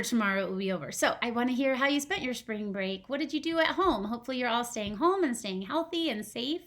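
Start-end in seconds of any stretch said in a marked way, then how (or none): none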